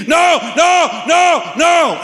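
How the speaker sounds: high, loud panicked scream. Retold or imitated. Preacher reliving his frightening vision, screaming. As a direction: scream it out in terror. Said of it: "I began screaming".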